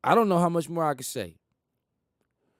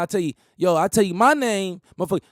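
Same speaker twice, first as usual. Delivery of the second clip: ordinary talk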